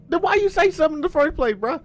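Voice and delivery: high, fast, exasperated bruh; mock exasperated voice. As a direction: fire it off high and quick. Mock quote of scared witness.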